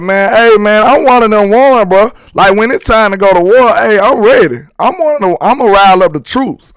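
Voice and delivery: high, pumped-up hype talk; hyped up. Real, not acted. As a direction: fire up the troops. Hyped up about going to war.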